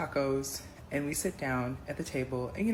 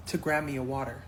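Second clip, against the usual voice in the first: ordinary talk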